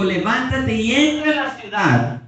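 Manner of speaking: loud, high preaching of the scripture; preaching at full force. Acted. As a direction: proclaim it to the whole church.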